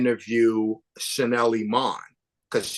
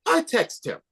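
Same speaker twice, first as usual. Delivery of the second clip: much higher mock accent; Iman; 13 semitones higher than usual. Filmmaker mimics the connected queen's quick reply, high put-on accent. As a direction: toss it off high in a badly imitated accent. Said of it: "That's my bad Iman, like, accent".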